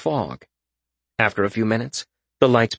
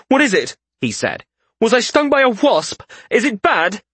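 high, louder anxious questions; alarmed questions; 10 semitones higher, 3 dB louder than usual. A narrator's reading. Malseus panics about his face, high and anxious. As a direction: ask in rising alarm.

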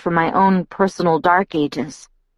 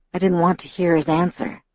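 ordinary delivery

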